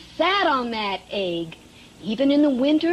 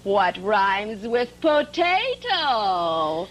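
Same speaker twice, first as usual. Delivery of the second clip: slow teasing question; 5 semitones lower, much slower than usual. Shown as a demonstration. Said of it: "teacher drawing out question".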